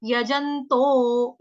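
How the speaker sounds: यजंतो stretched out very slowly; slow yajanto. Shown as a demonstration. Teacher says यजंतो slowly for class. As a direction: draw out यजंतो syllable by syllable. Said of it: "य पूरा, ज पूरा".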